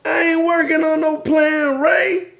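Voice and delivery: high, loud exasperated shout; angry denial. Acted. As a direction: yell it back in a quarrel. Acted bedtime quarrel, angry denial.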